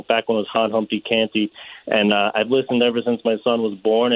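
ordinary talk

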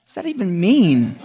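high, loud and fed up; exasperated. Retold or imitated. Frustrated veteran reacting to the yoga cue, loud and exasperated. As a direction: throw the question up in disbelief. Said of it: "I'm like".

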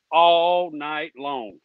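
very slow, stretched words; drawn-out emphasis. Real, not acted. Caller vowing to raise hell with those people, drawn-out emphasis. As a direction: drag out each word for effect.

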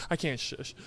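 ordinary talk, nothing marked

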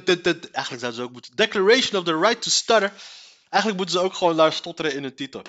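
ordinary talk

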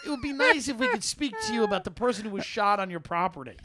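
high sing-song cop voice; sing-songy polite cop. Retold or imitated. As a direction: lilt it sweetly and politely. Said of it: "sing-songy".

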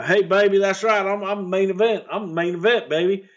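cocky high promo voice; bragging promo. Retold or imitated. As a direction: strut it like a heel cutting a promo. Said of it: "Buddy was bragging".

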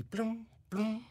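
high, soft mock thudding noise; imitated thuds. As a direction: mimic the bumps in the kitchen. Imitating objects moving in kitchen.

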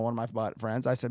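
ordinary delivery

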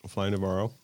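loud, upbeat podcast host welcome; show host intro. Acted. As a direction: open the show like an announcer.

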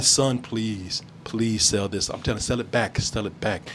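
low, soft mock plea; pleading dad. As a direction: beg him gently, low. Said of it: "tried to reason with him".

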